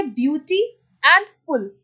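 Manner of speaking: Beauty and फुल as two separate pieces, pause between; teacher separating beauty from फुल; split into syllables. Shown as a demonstration. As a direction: break it apart as beauty, then फुल. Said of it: "फुल में आपका sound अलग है".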